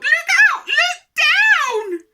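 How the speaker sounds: very high, loud alarm; piercing falsetto shout; yelled warning. A narrator's reading. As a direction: yell it to warn someone of danger. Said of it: "who yelled".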